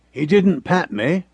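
ordinary delivery, nothing marked

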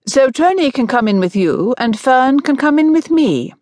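high, delighted suggestion; beaming. A narrator's reading. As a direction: announce the plan with a beam.

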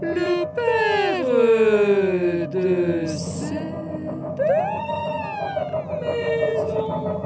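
very slow, eerie, high drawn-out voice; voice from beyond time. A narrator's reading. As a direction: moan it like a ghost beyond time. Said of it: "une voix d'au-delà du temps".

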